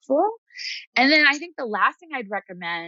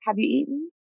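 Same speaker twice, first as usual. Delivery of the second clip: tender grandmother voice; 4 semitones higher than usual. Retold or imitated. Counselor voicing a client's grandmother, tender and caring. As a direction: play a doting grandmother offering rest and a hug. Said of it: "turn the volume up on your grandmother that says".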